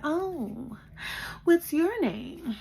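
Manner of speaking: high, slow sugary mom voice; mock sweet mother asking; mom's voice. Mom greeting her daughter's boyfriend, high and sweet. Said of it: "she was like".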